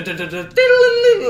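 imitated vibrator noise. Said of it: "whoop, whoop".

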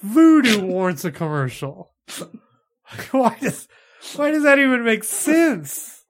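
loud, high, slow outrage; mock rage. Real, not acted. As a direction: rant with a laughing edge. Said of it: "I see the rage in your eyes".

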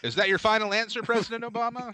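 high, fast quiz-host voice; Alex Trebek. Acted. Host grills a presidential contestant, showy. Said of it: "Alex Trebek would be like".

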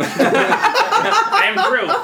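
very high, very slow squeaky Groot. Pretend. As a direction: drag out a tiny creature's I am Groot. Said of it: "It's not that".

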